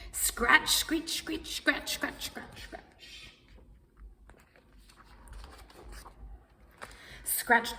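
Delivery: unvoiced fading scratch sounds; scratching fading to nothing; scratching noises.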